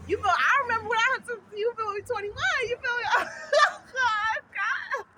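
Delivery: high squealing laugh-talk. Real, not acted. Vlogger cracking up remembering turning twenty-one, laughing.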